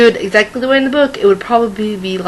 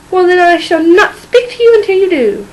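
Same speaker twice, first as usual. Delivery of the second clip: high, loud offended threat; indignant huff; 7 semitones higher, 5 dB louder than usual. Acted. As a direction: huff it like an outraged wife. Wife huffs in indignation.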